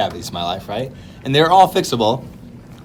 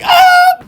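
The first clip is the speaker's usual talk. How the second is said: short, very high, loud scream; sudden piercing imitation shriek; mock pained scream; 10 dB louder than usual. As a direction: yelp as if your thumb is being crushed. Acting out grandpa's thumb being crushed.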